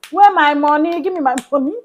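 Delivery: loud, high playful demand with a laugh; mock demand. Colleague demanding her rice money back, loud mock demand. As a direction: shout it like a friend half-joking.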